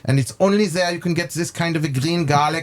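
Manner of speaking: lightly put-on German accent, chef rattling on. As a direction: imitate the chef's German accent while he explains. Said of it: "How do you like his, uh, little, uh, accent?".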